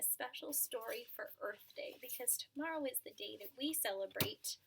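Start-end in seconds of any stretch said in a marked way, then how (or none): none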